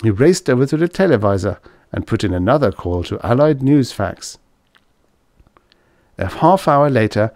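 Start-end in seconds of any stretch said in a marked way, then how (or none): none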